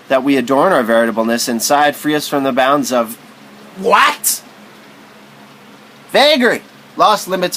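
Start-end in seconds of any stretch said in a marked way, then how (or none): 3.8-7.6 dramatic outburst. Acted. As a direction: fling the word out. Rhetorical outburst.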